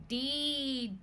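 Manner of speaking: ordinary delivery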